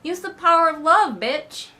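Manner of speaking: very high, loud sarcastic outburst; exasperated mock yell. Reviewer yelling at clueless servants, high exasperated mockery. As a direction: scream your frustration at the dumb servants. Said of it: "it's like".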